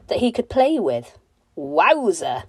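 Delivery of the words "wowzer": a delighted, louder little shout; wowzer exclamation. Acted. Narrator marvelling at the orange squidge toy, delighted exclaim. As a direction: pop it out with glee.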